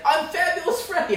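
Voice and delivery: high, loud comic impersonation; Joe Pesci. Acted. Comic picturing a famous actor as Freddy, high loud announcement. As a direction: announce it loud and high as a mock tough guy. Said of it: "if it was Joe Pesci".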